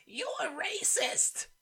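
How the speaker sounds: slow, high mocking voice; mock a politician crying racism; mock accusation. Mocking Warren's retort.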